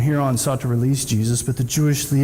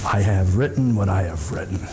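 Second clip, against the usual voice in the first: much lower, firm final verdict; stern Pilate; 8 semitones lower than usual. Retold or imitated. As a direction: shut the matter down flat. Pilate's curt final refusal.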